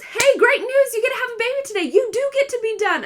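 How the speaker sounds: high, loud, fast sing-song nurse voice; bubbly nurse delivering happy news; overly cheerful nurse; chipper nurse. Retold or imitated.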